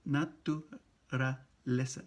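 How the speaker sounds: naturaleza drawn out slowly syllable by syllable. Shown as a demonstration. Teacher slowing naturaleza for students. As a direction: spell it out slowly. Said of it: "Na tu".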